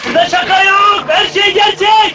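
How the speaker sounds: announcer shout. Acted. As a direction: boom it like a show host. Host announces show dramatically.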